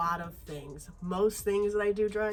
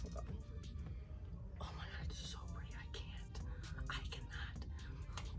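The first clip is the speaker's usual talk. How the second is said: breathless awed whisper; 9 semitones lower, 18 dB quieter than usual. Real, not acted. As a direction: whisper it in awe. Awed by the palette.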